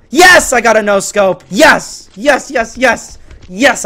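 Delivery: high, excited cheering; triumphant celebration. Real, not acted. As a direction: celebrate the kill loudly. Celebrates a no scope kill.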